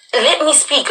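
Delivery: high-pitched plea to be heard; retold insistent plea. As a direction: raise the pitch, imitating a frustrated interjection. Pleading to be heard in argument.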